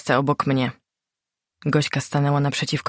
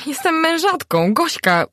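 excited shout; 12 semitones higher, slower than usual. A narrator's reading. Narrator tells Gośka she is married, excited cry. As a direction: burst out with Jestem on top. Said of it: "krzyknęłam podekscytowana".

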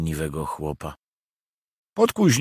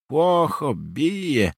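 cruel muttered remark; 6 semitones higher, much slower than usual. A narrator's reading. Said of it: "mruknął z okrutnym uśmiechem Hunia".

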